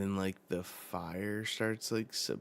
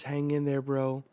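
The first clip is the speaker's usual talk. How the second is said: raised, louder plea; urgent encouragement; 6 semitones higher, 6 dB louder than usual. Acted. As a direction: urge a wounded friend to hold on. Acting urgent encouragement to injured man.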